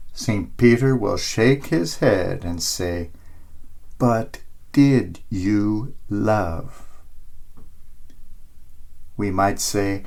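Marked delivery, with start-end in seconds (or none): 4.0-6.9 soft, slow and tender; gentle question. A narrator's reading. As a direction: ask it quietly, from the heart. Peter shakes his head, asks.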